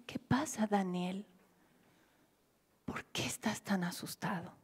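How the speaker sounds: soft, whispered comfort; gentle whisper. Retold or imitated. Big brother Pablo comforting scared Danielito, whispering. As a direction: hush a frightened child. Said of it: "Pablo grande dice".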